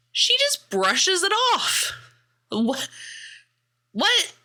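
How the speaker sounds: real indignation as she retells the date; incredulous. Real, not acted. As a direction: pitch shoots up in outrage. Indignant reaction to the story.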